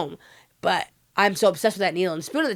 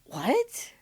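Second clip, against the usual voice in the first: small, high, softened question; stunned disbelief; 6 semitones higher, 6 dB quieter than usual. Retold or imitated. Daughter hearing of the death, retold stunned disbelief. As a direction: re-enact a stunned, barely voiced reaction.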